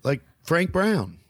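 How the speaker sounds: high, slow recognition; a light mock of an eager aunt; surprised relative. Relative at the family reunion hearing he is a comedian.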